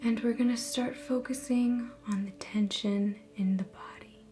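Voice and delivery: soft, measured meditation voice with long pauses; guided meditation. Acted. Host guiding viewers through a relaxation visualization, guided meditation. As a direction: ease listeners into calm, phrase by phrase.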